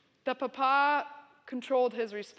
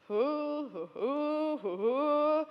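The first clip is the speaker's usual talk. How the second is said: imitating a host dad's rolling laugh; a lightly voiced chuckling hoo hoo; 2 semitones higher, much slower than usual. Host dad laughing at the burnt money, mock rolling laugh. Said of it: "this rolling laugh".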